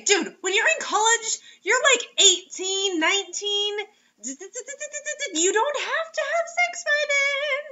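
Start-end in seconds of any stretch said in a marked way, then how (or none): 4.2-7.6 high, slow incredulous laugh; laughing exasperation. Real, not acted. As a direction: stretch it out in disbelief. Exasperated reaction to story.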